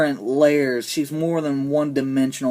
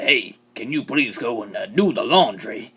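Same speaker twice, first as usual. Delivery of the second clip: lower, softened put-on courtesy; mock politeness; 5 semitones lower than usual. Husband asking women to do laundry, mock-polite and low. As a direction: ask ever so politely, in a put-on voice. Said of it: "he was just saying it all politely".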